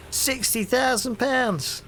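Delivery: slow, high, savouring each word; milk the number for drama; Cockney copper relishing the gossip punchline; big reveal. Acted.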